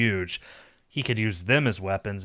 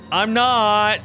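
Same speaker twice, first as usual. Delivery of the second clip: high, loud cry back; drawn-up wail of denial; exasperated retort; 11 semitones higher, 9 dB louder than usual. Acted. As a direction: blurt it out loudly in dismay. Silently answers the searching call.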